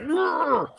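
high, held growl of rage; enraged growl. Retold or imitated. Imitating the hitman with the rage problem, high growl. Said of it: "with the rage problem".

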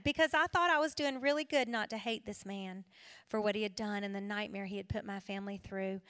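ordinary talk, nothing marked

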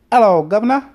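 high, loud hammed-up English accent; badly faked cockney greeting; mock English accent. Showing off his accents to his wife, mock English accent. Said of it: "I can do England".

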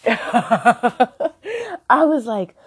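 laughing. Real, not acted. Laughs at her own reaction.

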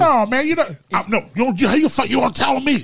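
heated, high-pitched protest; mock offended shout; angry high voice. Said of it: "his voice, even his voice changed".

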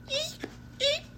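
high squeaky toy eek; squeaky shoe. A narrator's reading. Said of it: "like a squeaky shoe".

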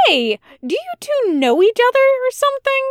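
put-on a suspicious kid barging into the adults' talk; high, pushy child interrupting; loud interruption. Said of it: "Ephemeron interrupted loudly".

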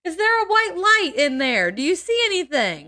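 high, sing-song mocking husband. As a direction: tease her toward death sweetly.

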